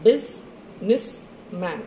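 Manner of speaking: slow, three separated beats in Businessman; syllable by syllable. Shown as a demonstration. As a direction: count out each syllable with a pause between. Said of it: "So three".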